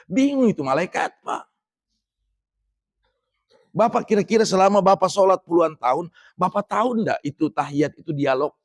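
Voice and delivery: usual speaking voice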